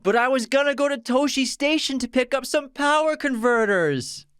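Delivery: put-on high, whining young farm boy; whiny Luke voice. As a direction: whine it like a sulky teenager. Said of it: "In a very whiny voice".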